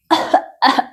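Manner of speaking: short dry retching coughs; re-enacted choking cough; imitated coughing fit. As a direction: act out starting to cough. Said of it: "y comencé a toser".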